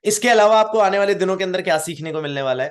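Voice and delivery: normal talking voice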